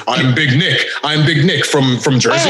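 low, mumbled wiseguy imitation; mock his low mumbled promo; low mumbling Big Nick. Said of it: "in this exact voice".

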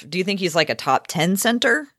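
normal talking voice

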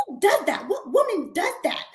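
mock disbelief, pitch jumping up; upset mother. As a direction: imitate an indignant mom questioning. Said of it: "now my mom's upset".

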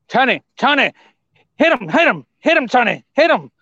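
imitating an excited ringside shout; mock urgent urging. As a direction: egg Tony on in quick bursts. Acting out excited interview moment.

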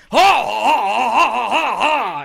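cackle-yell like Pacino losing it; Pacino flip-out laugh. Retold or imitated. Retelling Pacino's movie rant, a manic shouted laugh. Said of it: "classic Pacino, like, flip out time".

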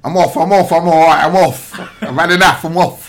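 loud, high Cockney manager rant; Neil Harris impression. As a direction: bellow it like an irate London manager. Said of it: "Superb impression".

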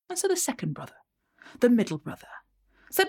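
ordinary delivery

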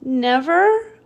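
high, pleading little voice; little bat voice. Acted. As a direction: ask it in disbelief.

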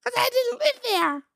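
very high squeaky puppet shout; Elmo. Acted. Defending the shower curtain incident in squealed Elmo voice. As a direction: protest in puppet falsetto. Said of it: "But we're Elmo voice".

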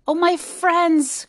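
slow, high, heartfelt cry; an elephant crying out in concern; anxious cry. A narrator's reading. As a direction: call out to dear friends. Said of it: "cried the elephant".

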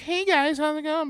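very high-pitched mock waitress greeting; Hooters waitress. Man imitating a waitress greeting his table, high falsetto. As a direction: squeak it out like a waitress working for tips. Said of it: "like a fucking Hooters waitress does".

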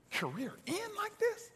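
incredulous echo. Retold or imitated. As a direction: throw the words back in disbelief. Disbelief at the doctor's words.

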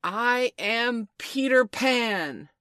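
high, loud, drawn-out declaration; ringing boastful cry; proud proclamation. A narrator's reading. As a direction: proclaim it slowly and grandly. Peter proudly reveals his name.

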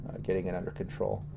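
ordinary talk, nothing marked

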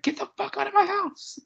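very high-pitched mock scream, half laughing; squealed, high imitation of screaming. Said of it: "he just starts screaming".

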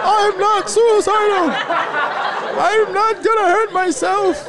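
high, loud, slow shrieked denial; hammed-up hysterical wail, pitched high; mock screaming plea. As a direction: screech it like a terrified man pleading. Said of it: "was screaming at".

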